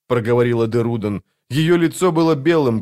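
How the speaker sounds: ordinary delivery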